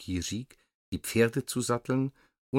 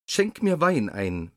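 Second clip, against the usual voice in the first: loud, higher royal order; commanding; 6 semitones higher, 5 dB louder than usual. A narrator's reading. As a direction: bark the order like a king. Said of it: "befahl er Jirik".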